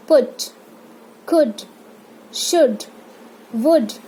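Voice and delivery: quick short u in पुट, could, should, would; each word brief, vowel not stretched; rapid short u. Shown as a demonstration. Teacher models the small u in पुट, could, should, would, quickly. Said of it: "rapid pronounce करना है".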